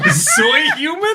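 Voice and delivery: soy human squeaked high through laughter; laughing mix-up. Real, not acted. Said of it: "Were you about to say soy human?".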